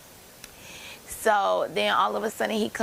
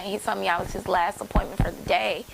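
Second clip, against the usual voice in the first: ordinary talk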